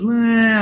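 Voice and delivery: high, drawn-out mock whine; jabby whine. Husband mimicking his first voice message to his wife, jabby and whiny. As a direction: imitate a petty, passive-aggressive "ehhh". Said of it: "a little jabby, a little passive aggressive".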